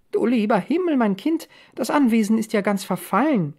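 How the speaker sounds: high, lilting dismay; astonished mother. A narrator's reading. Mother hears of the lady in the ruin, astonished.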